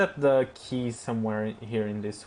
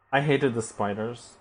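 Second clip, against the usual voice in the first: ordinary talk, nothing marked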